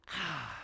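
soft, falling sigh; heavy sigh. Acted. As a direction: let out a weary sigh before admitting it. Sighs in place of series description.

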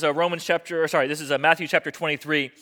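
usual speaking voice

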